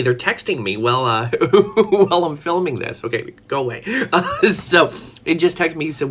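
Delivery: usual speaking voice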